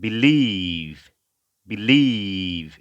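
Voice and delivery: "believe" with short "bi", loud long "lieve"; stress on LIEVE. Shown as a demonstration. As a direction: punch and stretch the second syllable of "believe". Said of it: "এরকম উচ্চারণ হবে".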